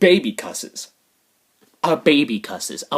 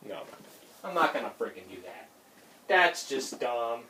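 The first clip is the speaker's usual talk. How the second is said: muttered dismissal; 5 semitones lower, 12 dB quieter than usual. Real, not acted. Vlogger dismissing a stunt idea, muttered low. Repeats the line, second more emphatic.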